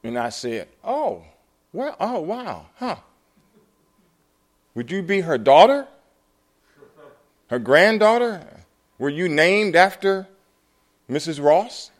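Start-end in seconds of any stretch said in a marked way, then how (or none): 0.8-3.0 high-pitched, flustered astonishment; acted surprise, pitched well up; taken aback. Stunned on hearing her name. 4.7-11.9 loud, high, disbelieving questions; acted disbelief, voice pitched up; incredulous questioning. Astonished questioning of the woman.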